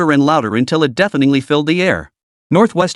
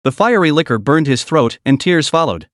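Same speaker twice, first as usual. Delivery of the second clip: plain reading voice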